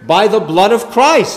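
"by the blood of Christ." punched high and loud; emphatic stress. Shown as a demonstration. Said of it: "Do you notice the emphasis here?".

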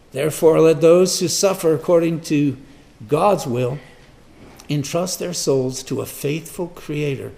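loud declaimed opening, easing by the end; scripture reading. Acted. Preacher repeating the verse to the congregation, declaimed scripture. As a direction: proclaim the verse, then settle.

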